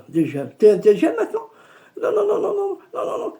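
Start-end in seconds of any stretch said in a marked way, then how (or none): 2.0-3.3 high, frantic string of no's; high panicked refusal. Retold or imitated. (refus paniqué et aigu)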